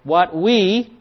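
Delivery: "we" drawn out slowly and punched; stress on "we". Shown as a demonstration. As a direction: stretch "we" to make it stand out. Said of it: "that's in the plural".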